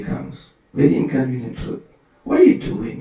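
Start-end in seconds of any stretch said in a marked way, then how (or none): 2.2-3.0 sharply high, mock-astonished scolding; high incredulous what are you doing; incredulous question. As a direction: ask it like a sage who can't believe it. Narada scolding the boy Dhruva.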